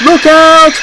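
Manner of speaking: very high, loud warning shout; alarmed cry. A narrator's reading. Driver warns Thomas before the crash, alarmed high shout. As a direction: yell a sudden high-pitched warning. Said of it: "cried his driver".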